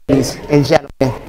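normal talking voice

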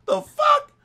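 very high laughing the fuck; incredulous squeal. Real, not acted. Gamer laughing in disbelief at an enemy.

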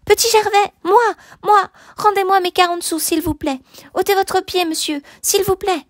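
put-on high-pitched begging little boy; pleading child. A narrator's reading. Little Gervais begging the man to move his foot, pleading.

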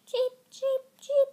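high chirping chicks; chick cheeps. Acted. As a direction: peep like tiny hatchlings. Baby chicks hatch.

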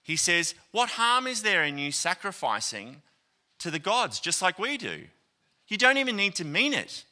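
high, fast, coaxing plea to let him off; pleading governor. Retold or imitated. Governor begging Polycarp to sacrifice, high and fast coaxing. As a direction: coax him like a desperate official trying to bargain. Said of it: "He's like".

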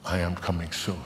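usual speaking voice

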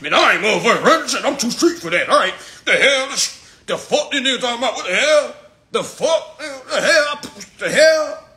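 high, loud indignant griping; hammed-up sulky outburst in bursts; mock hissy fit. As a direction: imitate a player ranting at the coach. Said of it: "you had a whole hissy fit".

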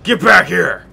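loud furious shout; yelling. Real, not acted. Player yelling at the beast to come back, loud shout. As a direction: bellow at the fleeing beast. Yelling at fleeing game creature.

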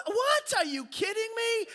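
high, loud disbelief; hammed-up incredulous yelp; acted amazement. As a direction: shout it high as if stunned by good news. Acting out amazed reaction on call.

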